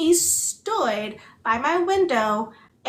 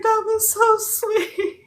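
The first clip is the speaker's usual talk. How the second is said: teary gush; 10 semitones higher, 4 dB louder, slower than usual. Retold or imitated. Retelling sobbing after the kindness.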